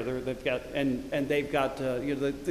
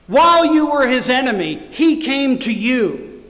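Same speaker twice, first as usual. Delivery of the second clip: loud, high, slow pulpit proclamation; impassioned preacher, booming and deliberate; preaching at full force; 6 semitones higher, 8 dB louder, slower than usual. Real, not acted. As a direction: drive each phrase home with stretched, ringing words.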